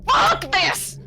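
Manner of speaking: high, loud furious shout; angry outburst. Acted. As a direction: snap and storm off. Player storms out of vehicle.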